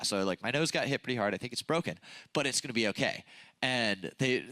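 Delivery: ordinary talk, nothing marked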